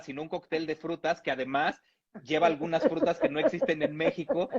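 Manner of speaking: usual speaking voice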